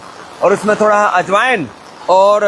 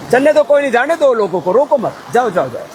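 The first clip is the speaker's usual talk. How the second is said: offhand instruction aside; 3 semitones higher than usual. Real, not acted. Speaker tells helpers not to stop people, offhand aside. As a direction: call out to let people pass, then drop away.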